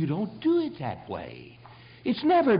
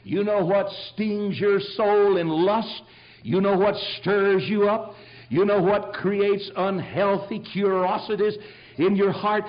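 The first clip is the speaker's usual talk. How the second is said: slow preaching questions; 7 semitones higher, 6 dB louder, slower than usual. Acted. Slowed, driving sermon questions.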